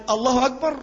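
"الله أكبر" rising at the end like a question; questioning takbir. Shown as a demonstration. Teacher shows how a long hamza makes it a question, rising tune. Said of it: "كأنه بتسأل".

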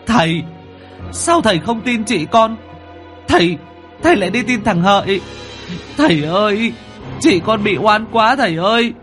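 high, anguished crying out over a body; wailing grief. A narrator's reading. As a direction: wail in protest for your dead sister. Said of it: "ôm lấy xác chị mà gào khóc". (gào khóc thảm thiết)

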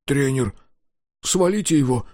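put-on high, rising trembling child. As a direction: plead with a shaking voice. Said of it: "дрожащим голосом произнёс Дозер".

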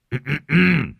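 loud, self-important ahem; pompous throat clearing. Acted. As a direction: clear the throat to demand attention. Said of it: "e hèm một cái". (e hèm ra vẻ quan trọng)

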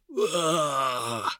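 high drawn-out wail of pain; acted creature howl, held long; troll's pain squeal. A narrator's reading. Troll stabbed in the foot, high pained squeal. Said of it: "завизжал от боли тролль".

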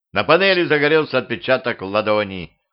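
ordinary delivery, nothing marked

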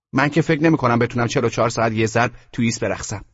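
put-on second child character. Second child replies in the story.

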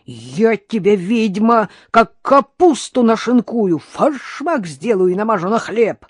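loud, high, emphatic ranting; furious threats. A narrator's reading. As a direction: hammer out each threat angrily. Karina screams threats at mother-in-law. (яростно угрожая)

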